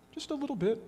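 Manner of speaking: high, soft ironic aside; dry wink at the congregation; sarcastic understatement. Real, not acted. Preacher teasing the congregation about overreacting, in a high ironic aside. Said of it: "ever feel like it's a little bit of a reaction".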